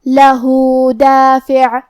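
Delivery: لہو with the final u held two counts, slow; two-count madd. Shown as a demonstration. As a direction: stretch لہو for two beats. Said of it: "دو مد کے برابر لمبی کریں گے". (لہو کو دو مد کے برابر لمبا)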